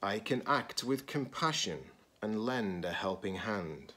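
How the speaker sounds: expressive picture-book read-aloud; reading to children. Acted. Teacher reading a picture book to students, expressive read-aloud.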